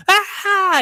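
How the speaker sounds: sudden high excited shout; excited yelp. Retold or imitated. Fan spotting Broncos hoodie.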